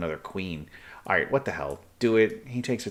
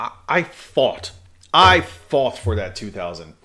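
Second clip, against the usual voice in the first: louder, hammering out the claim; emphatic pride; 9 dB louder than usual. Real, not acted. Streamer defends his two thousand rating to chat, louder and emphatic. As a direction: insist you earned every point.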